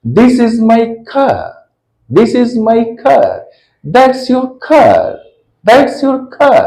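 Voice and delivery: "car" said as /kʰa/ with no R, slowly; non-rhotic car. Shown as a demonstration. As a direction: drop the R at the end of "car", slow and soft. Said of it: "हम लोग बोलेंगे car".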